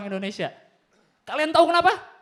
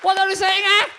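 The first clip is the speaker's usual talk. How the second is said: high loud shouting like he can't hear; mock deaf gangster shouting; deafened yell; 8 semitones higher, 9 dB louder than usual.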